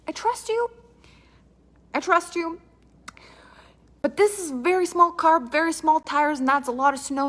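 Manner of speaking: high, strained worried voice; nervous high pleading. Retold or imitated. Nervous reply on snowy road.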